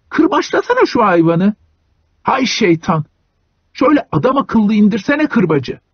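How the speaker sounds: high, scolding grumble; grumbling. A narrator's reading. Hunchback cursing Iona, high and scolding. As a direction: nag and curse at the driver. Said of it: "Kambur söyleniyordu".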